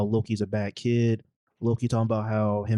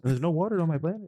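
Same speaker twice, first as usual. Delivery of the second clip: higher, quick mock Kiwi rock guy; mock Korg voice; 6 semitones higher, faster than usual. Friend riffing on Korg, higher quick mock Kiwi voice. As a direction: riff in a light Kiwi rock voice.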